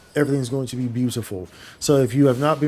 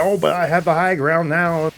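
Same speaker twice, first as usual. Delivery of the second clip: higher, louder put-on movie quote; Obi-Wan Kenobi; 4 semitones higher, 3 dB louder than usual. Gamer mimics a film hero's line, put-on voice. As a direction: play the hero claiming the upper hand. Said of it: "Obi-Wan's like".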